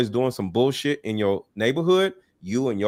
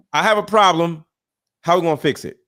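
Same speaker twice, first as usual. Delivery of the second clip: louder, higher, plain-spoken demand; firm, direct; 5 semitones higher, 4 dB louder, faster than usual. Retold or imitated. Host acting how men confront a problem, firm and louder. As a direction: step up and state it squarely. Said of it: "Men present themselves and say".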